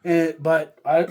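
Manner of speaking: ordinary talk, nothing marked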